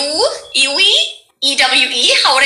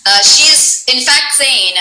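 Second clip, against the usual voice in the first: ordinary talk, nothing marked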